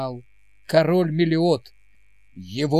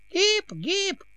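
thin high cheer. Acted. Old advisor leads a cheer.

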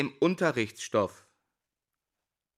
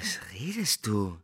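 whisper; 6 semitones lower, 6 dB quieter than usual. Acted. Confused whisper to colleague.

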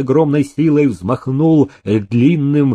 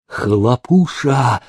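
hoarse rasp; much slower than usual. A narrator's reading. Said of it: "прохрипел Иван Перфильевич".

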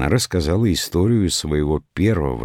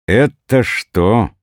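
higher, louder, drawn-out exclamation; slow, raised-voice exclamation; exclaiming; 7 semitones higher, 5 dB louder, much slower than usual. A narrator's reading. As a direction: burst in, stretching both words. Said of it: "воскликнул третий из странников".

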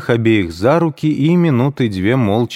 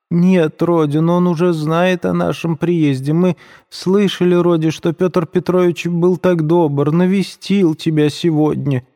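put-on higher, timid old mother's voice; 10 semitones higher than usual. A narrator's reading. Пульхерия Александровна робко говорит Роде, высоким робким голосом. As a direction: offer the news to her son with a timid, anxious voice. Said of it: "с некоторой робостью прибавила".

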